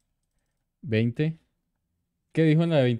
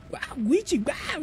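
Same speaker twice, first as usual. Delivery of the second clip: high yapping dog imitation, a lot higher; playful bark like a little dog; mock dog barking; 13 semitones higher, 4 dB quieter, much slower than usual. Streamer imitating the chat alert as a barking dog. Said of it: "van a poner la alerta".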